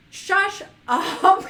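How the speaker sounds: loud playful shush up; teasing call to hush; mock shush. Real, not acted. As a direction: hush him, laughing. Teasing a chat guest.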